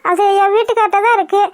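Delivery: usual speaking voice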